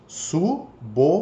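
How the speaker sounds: "suboficial" split slowly into su-bo-fi-ci-al, a pause after each syllable. Shown as a demonstration. Teacher showing students the syllable division, "suboficial" said slowly piece by piece. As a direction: break "suboficial" into separate, evenly spaced syllables. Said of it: "ele entrou junto na separação".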